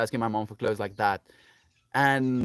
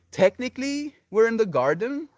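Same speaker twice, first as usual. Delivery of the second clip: "Technically" pitched high and a little louder; raised, hedging "Technically"; high-pitched hedge; 11 semitones higher, 4 dB louder than usual. Real, not acted. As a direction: lift "Technically" right up as a playful qualifier. Playful hedge answering the garden question.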